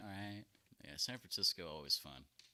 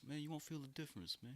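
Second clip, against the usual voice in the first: high, softer coaxing voice; mock propositioner; 7 semitones higher, 6 dB quieter than usual. Friend pretends to be the homeless man, jokingly coaxing. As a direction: playfully wheedle as the rejected man.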